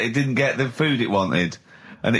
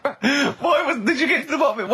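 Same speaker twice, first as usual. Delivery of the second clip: laughing; 14 semitones higher than usual. Real, not acted. Asking through laughter.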